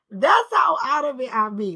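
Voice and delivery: words squeezed through loud laughter; laughing. Real, not acted. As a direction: laugh at yourself while saying it. Laughing at herself.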